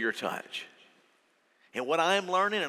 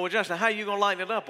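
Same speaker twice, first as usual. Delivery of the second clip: mock congregation question; 6 semitones higher, 3 dB louder, faster than usual. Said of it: "And you go".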